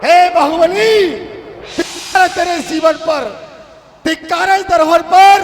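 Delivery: loud, high, scornful condemnation; thundering rebuke. Acted. Heavenly voice shames Bahubali for fighting his brother, shouting. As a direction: boom it down from on high in disgust.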